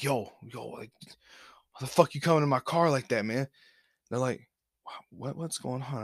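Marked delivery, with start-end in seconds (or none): 0.0-3.5 tense hushed whisper; whispering to the delivery person from a ducked-down seat; urgent whisper. Retold or imitated. Hiding from cop in car. 4.8-6.0 much softer, puzzled murmur; delivery person answering quietly at the car window; hushed confused reply. Retold or imitated. Confused reply under cop's watch.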